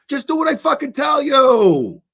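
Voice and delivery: shouted order. Acted. Drunk conspirator yells at his buddy to obey, loud shout. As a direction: bark the order at the crew.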